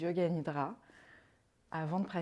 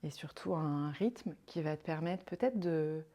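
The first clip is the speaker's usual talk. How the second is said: calm, unhurried meditation voice with long pauses; guided meditation; 3 dB quieter than usual. Acted. Yoga guide leading a listener into rest and counted breathing, guided meditation. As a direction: guide them softly into rest, leaving space between phrases.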